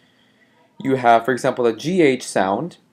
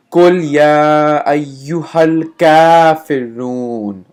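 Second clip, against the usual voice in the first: Quran recitation; 8 dB louder than usual. Shown as a demonstration. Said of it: "the way we say it is".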